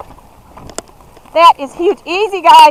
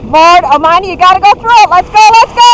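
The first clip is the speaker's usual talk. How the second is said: high, loud hike calls; excited urging; 9 semitones higher, 7 dB louder than usual. Real, not acted. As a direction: cheer the dogs through. Urging dogs through water.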